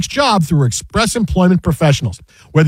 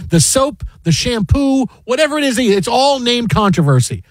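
ordinary talk, nothing marked

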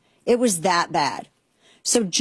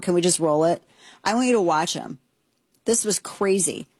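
ordinary delivery

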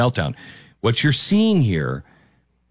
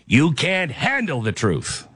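a light film-villain growl, slow and drawn out; slow, menacing movie-quote delivery, lightly done; Jack Nicholson; 4 semitones higher than usual. Retold or imitated. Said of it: "I'm turning into Jack Nicholson".

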